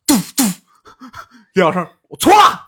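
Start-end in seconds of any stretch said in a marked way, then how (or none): 2.1-2.6 higher, louder cry of apology; retold loud apology. Student kneeling before the teacher, loudly crying out an apology.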